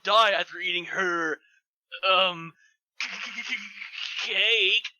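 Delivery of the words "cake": ordinary talk, nothing marked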